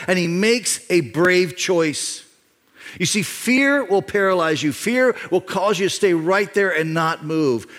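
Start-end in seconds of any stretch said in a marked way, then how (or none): none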